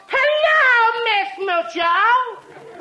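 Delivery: loud, high and very slow, drawn out like a lovesick fan; swooning hello. Acted. Smitten man greeting the actress Bessie in the street, swooning drawn-out hello. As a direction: melt over her name as the star walks by.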